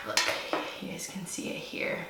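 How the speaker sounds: soft unvoiced whisper. Real, not acted. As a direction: whisper it to the camera. Whispering to camera.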